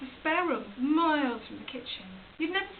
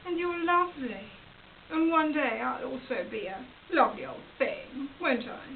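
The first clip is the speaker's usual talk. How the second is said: ordinary talk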